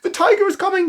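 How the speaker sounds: high, loud panicked warning; panicky. Acted. Tilly warning the girls the tiger is coming, panicky high cry. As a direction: sound the alarm in a fright. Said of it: "she said in a panicky voice".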